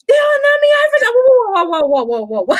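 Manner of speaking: high, loud, mock flustered outburst fading out; hammed-up heated rant trailing into whoas; imitating upset colleague. Upset colleague ranting in dialect, mock flustered. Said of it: "He got upset one day".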